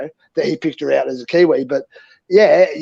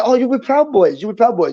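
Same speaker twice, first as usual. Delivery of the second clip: higher-pitched mimicry of a Chinese man; imitated Chinese man; 7 semitones higher than usual. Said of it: "we had this little Chinese man walk up to us".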